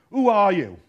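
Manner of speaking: loud, imitated shout from the minister; shouted question. Minister shouting at the lone visitor, shouted demand. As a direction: shout it as a challenge. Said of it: "this guy just keeps shouting and shouting".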